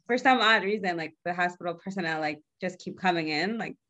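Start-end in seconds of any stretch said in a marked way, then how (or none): none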